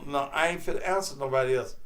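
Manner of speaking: usual speaking voice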